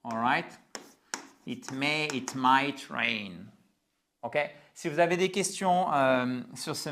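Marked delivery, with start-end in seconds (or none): 1.5-3.5 it might rain said slowly, stress on might; slow model phrase. Shown as a demonstration. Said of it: "en trois mots". (lent, might appuyé)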